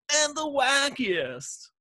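high, drawn-out wacky finish; over-the-top announcer flourish; hammed-up blurb climax. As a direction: stretch it out high like a big punchline.

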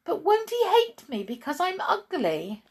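very high, loud plaintive dog voice; worried dog. Acted. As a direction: fret anxiously as a little dog. Anxious dog asks about new owner.

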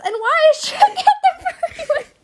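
high, loud laughing disbelief; incredulous laughing. Real, not acted. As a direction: demand answers through laughter.

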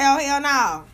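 normal talking voice

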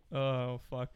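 ordinary talk, nothing marked

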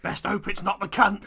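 loud, slow quiz-host dread; Bradley Walsh. Acted. Host dreading the next chaser, loud mock dismay. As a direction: ham up the host's dismay. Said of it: "Bradley Walsh was like".

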